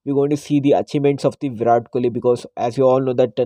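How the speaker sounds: normal talking voice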